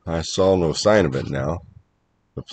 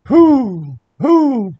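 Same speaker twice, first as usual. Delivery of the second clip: two loud, high owl hoots; high, drawn-out "hoo" calls; 6 dB louder than usual. Acted. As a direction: hoot like an owl from the rafters.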